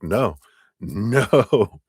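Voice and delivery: rising no with laugh; mock refusal to let go, laughing; laughing protest. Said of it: "we're like".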